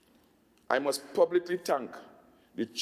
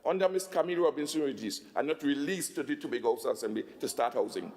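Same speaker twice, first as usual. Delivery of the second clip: ordinary talk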